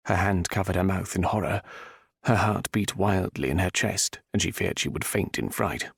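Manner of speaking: ordinary delivery, nothing marked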